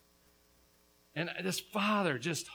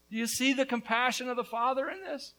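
ordinary talk

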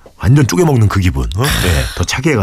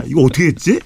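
normal talking voice

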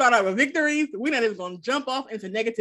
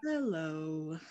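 sultry hello; 6 semitones lower, 12 dB quieter than usual. Retold or imitated. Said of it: "'Cause you said".

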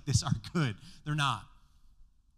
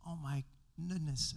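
lightly voiced son's alarm; a hint of a kid going ouch, yikes; mild mock fright; 4 dB quieter, slower than usual. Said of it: "as my son would say".

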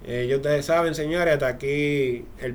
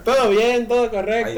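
Spain Spanish streamer voice, higher; chirpy Spanish streamer check-in; AuronPlay impression; 6 semitones higher, 4 dB louder than usual. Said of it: "Auron Play".

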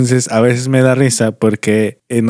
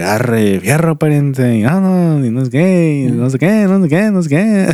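mock northern Mexican slang, rising higher; norteño imitation; 5 semitones higher than usual. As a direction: poke fun at how people think northerners talk. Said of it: "todos hablamos así como que". (imitación burlona del habla norteña)

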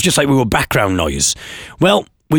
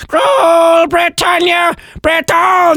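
very high, drawn-out parrot screech; parrot reciting; 4 dB louder, slower than usual. Acted. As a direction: screech the rhyme like a parrot, slowly. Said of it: "So a law-breaking parrot there".

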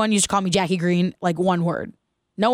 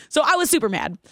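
ordinary delivery

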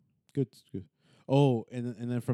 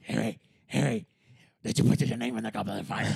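Dumbledore voice; 4 dB louder than usual. Retold or imitated. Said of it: "Dumbledore talking to Harry".

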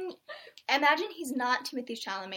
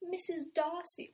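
slow, soft, raised imitation; mock-romantic film quote; romantic hero; 5 semitones higher, 6 dB quieter, slower than usual. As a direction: draw the name out tenderly, imitating a film lover. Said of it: "he's all like".

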